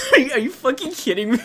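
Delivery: high, loud outburst breaking into laughter; mock outrage, cracking up through it; laughing disbelief. Rejected boy reading her text, laughing disbelief.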